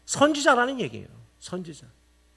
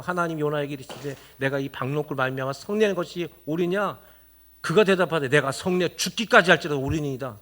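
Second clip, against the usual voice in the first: low, steady Bible reading; scripture reading; 6 semitones lower than usual. Acted. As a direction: read the verse out to the congregation. Reads scripture again. (낮고 차분한 성경 봉독)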